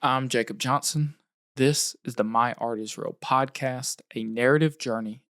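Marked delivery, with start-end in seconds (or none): none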